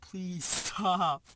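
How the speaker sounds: hammed-up frightened begging; mock-scared begging. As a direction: beg him to stop, laughing through it. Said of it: "so scared, shaking".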